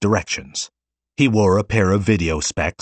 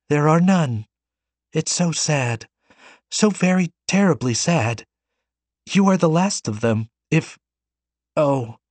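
put-on higher, mildly tearful girl; near sobs; 8 semitones higher than usual. A narrator's reading. Girl grieving for humanity, mild tearful lament. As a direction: hold back tears, not quite breaking. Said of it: "she could barely contain her sobs".

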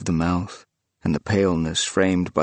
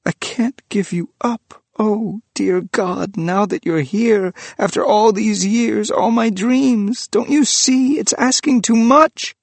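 desperate outburst; 6 dB louder than usual. Acted. Priest begging the returned figure to understand, rising in desperation. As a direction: pour it out, rising to a cry.

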